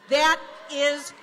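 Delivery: slow, loud, punched statement; emphatic condemnation. Real, not acted. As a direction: hammer it home to the crowd. Rally speaker condemning cuts.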